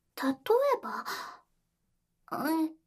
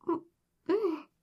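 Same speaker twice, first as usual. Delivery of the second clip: ordinary delivery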